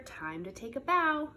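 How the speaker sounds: ordinary delivery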